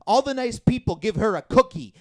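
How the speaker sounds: ordinary talk, nothing marked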